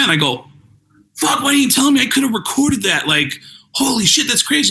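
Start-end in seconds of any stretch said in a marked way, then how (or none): 1.1-4.7 high-pitched, worked-up protest; excited disbelief. Retold or imitated. To the DJ who aired his voice, high excited disbelief. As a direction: blurt it out high, thrilled and startled.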